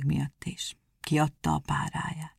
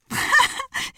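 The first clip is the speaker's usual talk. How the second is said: high, put-on hare laugh; hare cackling; 4 dB louder than usual. A narrator's reading. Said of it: "azt se tudta, hová legyen a kacagástól".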